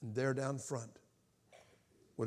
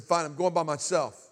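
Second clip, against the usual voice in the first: loud retold comeback; defiant retort; 3 semitones higher, 10 dB louder than usual. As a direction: throw it back loudly. Acts out defiant answer to Mark.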